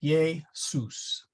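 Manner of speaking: "Jesus" split as Latin ye-sus; syllable by syllable. Shown as a demonstration. Teacher showing single-consonant split, syllable by syllable. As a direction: break "Jesus" after the first vowel, ye, sus. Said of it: "terminate the syllable at the vowel".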